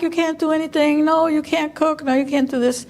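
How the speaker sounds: mock the fussing relatives who forbid everything; overprotective family. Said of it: "they were like".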